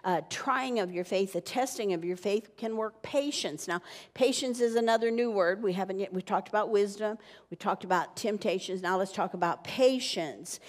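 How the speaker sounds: ordinary talk, nothing marked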